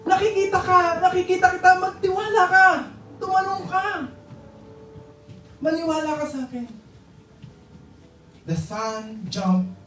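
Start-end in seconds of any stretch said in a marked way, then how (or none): 0.1-3.9 high-pitched, insistent acted calling; father urging. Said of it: "The father said". 5.6-6.7 high, quick, softer plea; father pleading. Acted. Father pleads with son to trust.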